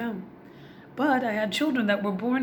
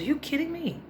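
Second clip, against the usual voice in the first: surprised; 10 semitones higher than usual. Retold or imitated. Mother reacts to son.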